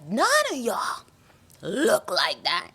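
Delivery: high, slow disbelief; drawn-out "none of y'all" in disbelief; exasperated quote. Retold or imitated. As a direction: stretch it out in disbelief. Retold reaction, drawn out.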